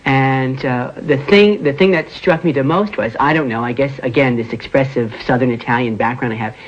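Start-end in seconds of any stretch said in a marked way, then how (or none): none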